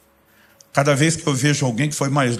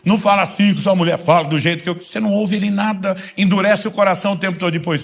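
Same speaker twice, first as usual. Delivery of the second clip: preaching hard, raised pitch and punchy stresses; forceful exhortation; 5 semitones higher than usual. Real, not acted. Preacher challenging churchgoers about obedience, forceful exhortation. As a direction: drive each challenge home to the congregation.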